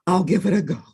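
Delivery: lightly voiced grudging bear; mild bear voice; a hint of a bear. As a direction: agree gruffly. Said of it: "Bear said".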